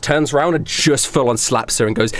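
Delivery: usual speaking voice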